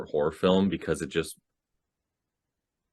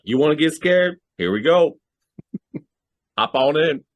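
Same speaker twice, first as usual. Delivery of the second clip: high, loud, eager imitation of parents; parents' invitation; 10 semitones higher, 6 dB louder than usual. Parents daring their kid to watch horror, imitated high and loud. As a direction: call out an excited, teasing offer, pitched up. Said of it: "My parents were like".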